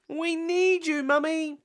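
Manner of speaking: put-on high, slow little-girl plea; Peppa's voice. Peppa begging Mummy for help, high and slow. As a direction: plead like a small piglet.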